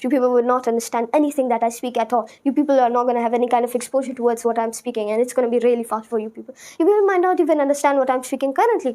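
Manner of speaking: very fast, words run together; fast accent. Shown as a demonstration. Showing listeners the fast accent used when thinking, very fast. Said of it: "so then I use the fast accent".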